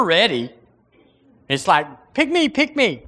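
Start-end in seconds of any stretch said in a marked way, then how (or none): 2.1-3.0 high-pitched, pleading eagerness; eager volunteer. Acted. Preacher voicing someone ready for their chance, high and eager. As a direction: beg to be chosen in a high voice.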